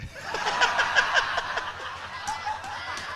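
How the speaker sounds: high, breathless burst of laughter; real laughter bubbling over; hearty laugh. Real, not acted. Audience laughter, speaker laughing.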